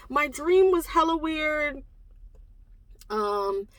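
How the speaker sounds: ordinary talk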